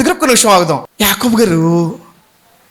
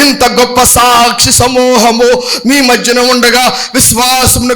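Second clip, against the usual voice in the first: slow, loud proclaimed verse; solemn scripture reading; 3 semitones higher, 3 dB louder, slower than usual. Acted.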